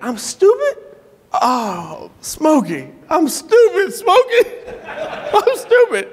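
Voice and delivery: high-pitched, hammed-up disbelief; mock incredulity. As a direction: imitate an offended man who can't believe it. Man reacts to being called stupid.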